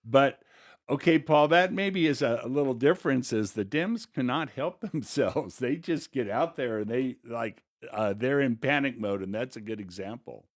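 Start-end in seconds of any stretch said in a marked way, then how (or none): none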